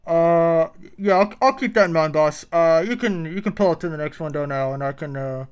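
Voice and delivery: nervous fast food employee talking to boss; drive thru speaker. Acted. Plays employee Michael.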